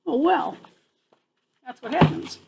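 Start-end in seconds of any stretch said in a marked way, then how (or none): none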